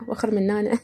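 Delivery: soft Iraqi shoo-away, وخر منانا; Iraqi pronunciation. Shown as a demonstration. Speaker demonstrating Iraqi dialect to Algerian viewers, soft Iraqi "وخر منانا". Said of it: "هكذا".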